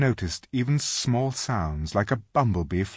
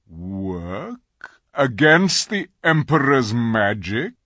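frowning; 3 semitones higher, 6 dB louder, much slower than usual. Acted. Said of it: "with something like a frown".

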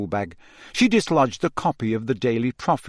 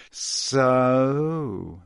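slowly drawn out. A narrator's reading. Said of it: "said Malfoy slowly".

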